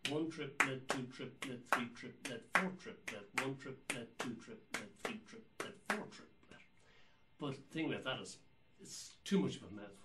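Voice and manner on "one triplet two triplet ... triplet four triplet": steady triplet chant; rhythmic triplet counting. Shown as a demonstration. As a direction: count each beat in three. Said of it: "these could be counted".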